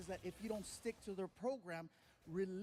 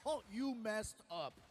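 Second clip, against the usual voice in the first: ordinary talk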